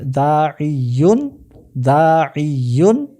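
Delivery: দাইয়ুন drawn out slowly, long daa, doubled y, ending -yun; slow teacher's demo of দাইয়ুন as daa-iy-yun; full-form pronunciation. Shown as a demonstration. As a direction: model দাইয়ুন syllable by syllable. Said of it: "উচ্চারণ হবে".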